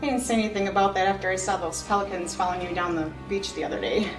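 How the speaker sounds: quick hushed teasing aside; fast quiet aside. Acted. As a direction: rush it under your breath. Teasing aside about pelicans.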